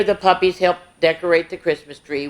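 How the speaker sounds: plain reading voice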